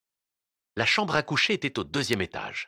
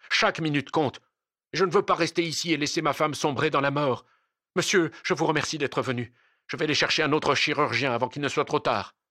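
indignant husband in a raised, urgent voice; angry protest; 5 semitones higher than usual. A narrator's reading. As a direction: insist angrily at a higher pitch. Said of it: "protesta le Turc en colère".

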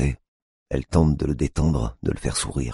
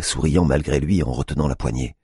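ordinary delivery, nothing marked

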